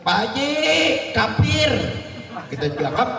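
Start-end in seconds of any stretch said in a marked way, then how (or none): 0.1-1.7 higher, called-out greeting; calling out on street. Retold or imitated. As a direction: shout across the road. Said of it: "dari jalan kita jauh". (memanggil dari jauh)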